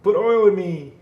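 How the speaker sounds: high, loud mock machine plea; squeaky machine's plea. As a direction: voice the computer begging for oil. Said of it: "it's making that sound, like".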